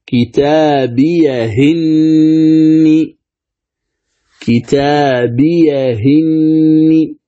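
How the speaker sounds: كتابيهن drawn out slowly, the hamza vowel moved onto the ha; تلاوة كتابيهن بنقل الحركة; naql reading. Shown as a demonstration. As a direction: recite كتابيهن slowly with the kasra carried to the ha. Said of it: "نقل حركة الهمز إلى هاء السكت، ماذا تصير؟".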